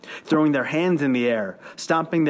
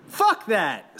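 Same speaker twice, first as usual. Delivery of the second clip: defiant yell, pitched higher; 7 semitones higher than usual. A narrator's reading. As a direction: spit it out like a rallying cry. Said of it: "someone yelled".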